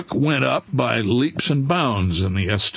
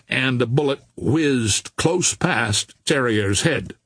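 ordinary delivery, nothing marked

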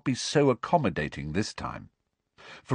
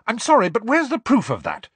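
high, loud snapping challenge; snapped; 14 semitones higher, 6 dB louder than usual. A narrator's reading. Hermione to Luna, snapping. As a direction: snap back at her. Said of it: "snapped Hermione".

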